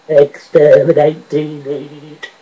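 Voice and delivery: slow robotic monotone; drawn-out robot command; Cyberman. Acted. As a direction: intone each word like a machine. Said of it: "a Cyberman".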